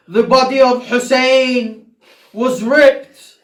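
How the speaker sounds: loud, high mournful preaching; impassioned preaching. Acted. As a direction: preach the lament at full force. Preaching at full force.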